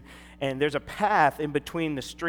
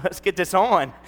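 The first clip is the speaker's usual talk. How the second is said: ready for a fight; 5 semitones higher, 10 dB louder than usual. Retold or imitated. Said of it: "'cause I was like".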